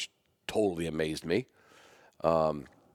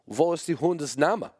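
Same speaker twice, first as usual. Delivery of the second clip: put-on higher German-accented question, rising at the end; 9 semitones higher than usual. Imagined German judge asking the dog's name, German accent. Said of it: "German judges or German immigrants".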